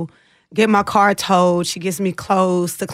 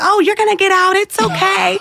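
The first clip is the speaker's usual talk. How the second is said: much higher, breezy mock reassurance; consoling cellmates; 11 semitones higher than usual. Cellmates who'd been locked up before reassuring her, high and breezy. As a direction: imitate veteran girls brushing it off lightly.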